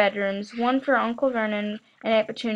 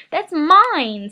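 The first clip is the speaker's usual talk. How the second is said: louder, higher protest; 6 semitones higher, 7 dB louder than usual. A narrator's reading. Boy protesting to his uncle, loud. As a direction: claim it back hard.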